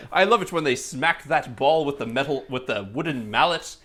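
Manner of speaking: gushing mock-British sports fan; hammed-up English accent, savouring the last words. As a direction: play a delighted Brit who barely knows baseball. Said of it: "Americans who have British accents".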